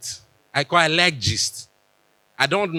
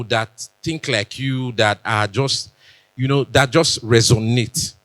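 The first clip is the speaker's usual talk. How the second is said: ordinary talk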